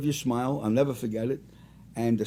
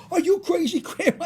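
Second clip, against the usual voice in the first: much higher, laughing disbelief; incredulous laughing protest. Retold or imitated. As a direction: laugh off grandma's praise in mock outrage. Protesting grandma's compliment.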